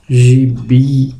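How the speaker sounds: "gibi" with the final "bi" drawn out; teacher's demonstration of "gibi", stressed at the end; stress on last syllable. Shown as a demonstration. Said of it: "a última sílaba vai ser a sílaba tônica".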